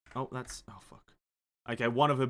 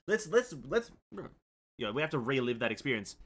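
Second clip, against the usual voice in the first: normal talking voice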